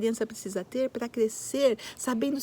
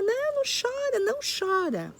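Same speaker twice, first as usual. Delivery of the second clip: higher, brushing off the crying; hushing adult; 12 semitones higher than usual. Retold or imitated. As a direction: pitch up to shush a crying kid. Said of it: "Aí vem o adulto e fala".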